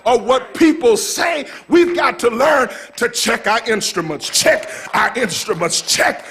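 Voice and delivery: rousing, rhythmic sermon delivery; fired-up preacher working the crowd; full-force preaching. Real, not acted. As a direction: preach it out to the congregation, driving each phrase.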